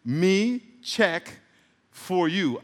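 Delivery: "Me check for you" slowly, word by word; deliberate, drawn-out "Me check for you"; spelled-out translation. Shown as a demonstration. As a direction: separate "Me", "check" and "for you" with pauses.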